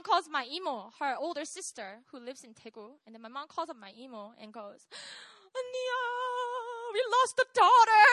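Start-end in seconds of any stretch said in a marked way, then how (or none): 5.5-8.1 mock wailing. Mom phones her older sister about losing daughter, mock wailing. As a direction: wail it to big sister, exaggerated. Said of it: "was also crying on the phone".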